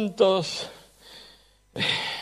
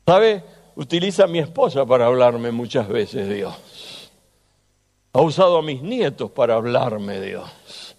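normal talking voice

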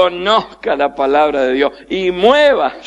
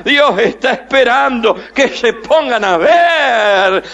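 usual speaking voice

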